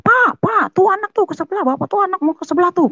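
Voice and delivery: mother yelling. Retold or imitated. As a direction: imitate mother shouting for her husband. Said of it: "sampai teriak-teriak sama bapak saya".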